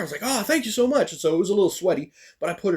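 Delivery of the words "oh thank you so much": grateful. Retold or imitated. Grateful reply, voiced very high.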